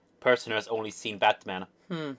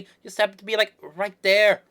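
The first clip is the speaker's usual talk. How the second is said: high, loud acted objection; indignant self-defence, pitched up; defensive protest; 11 semitones higher, 10 dB louder than usual. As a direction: protest loudly, stretching the last words. Acted quarrel over eavesdropping.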